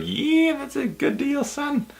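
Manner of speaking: high, soft put-on voice, drawing out "son"; a playful high character voice approving the deal; mock old-timer. The vlogger voicing an imagined approver of a five-dollar deal in a high put-on voice. Mock voice praising cheap price.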